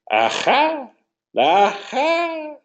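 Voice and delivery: put-on high, nasal-free old man "Aha!". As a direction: play a chuckling old grandfather.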